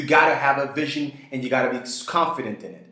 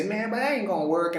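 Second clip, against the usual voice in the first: higher, put-on voice of a doubter; mock naysayer; 7 semitones higher, 3 dB quieter than usual. Naysayer scoffing at your gallery plan, mocking put-on voice. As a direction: scoff at the plan like a know-it-all.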